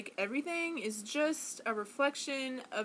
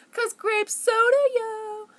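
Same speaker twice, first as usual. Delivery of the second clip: high, loud excited shout; exuberant, pitched-up holler; playful hype; 11 semitones higher, 11 dB louder, slower than usual. Pretend. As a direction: belt it out as a silly cheer. Playful outburst about soda.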